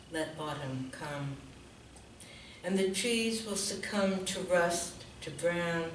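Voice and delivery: measured recital, each line falling softly away; poetry reading. Real, not acted. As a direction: recite it as a quiet invocation, pausing between lines. Reads autumn poem aloud.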